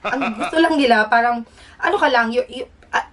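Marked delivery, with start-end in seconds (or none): none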